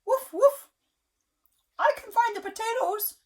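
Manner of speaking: very high, loud puppy voice; dog voice. A narrator's reading. Parent reading to child, the dog offers help. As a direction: bark then volunteer like an eager pup. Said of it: "said the dog".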